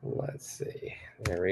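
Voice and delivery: whispered aside to himself; a private whispered aside. Real, not acted. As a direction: breathe it under your breath while working. Muttering while editing banner.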